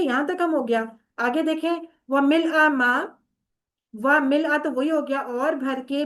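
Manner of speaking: normal talking voice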